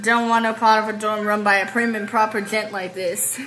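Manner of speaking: complaining Southern storyteller; Southern accent. Acted. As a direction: grumble in a country Southern accent. Reads Southern character's lines.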